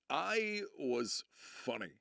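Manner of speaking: "I" and "funny" drawn out slowly; emphatic boast. Real, not acted. Streamer boasts of his improv days, slow emphatic delivery. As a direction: stretch "I was funny" out slowly for weight.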